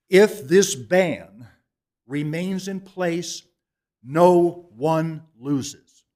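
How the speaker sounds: slow, loud, every word weighted; emphasized. Real, not acted. Speaker urging the council, slow heavy emphasis. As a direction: lean on each word to drive it home to the council. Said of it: "no, emphasize".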